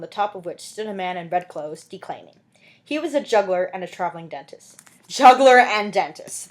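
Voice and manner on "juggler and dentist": loud, slow, delighted aside; amused exclamation. Real, not acted. Reader breaks off to marvel at the juggler, loud and slow. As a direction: savor the oddity out loud.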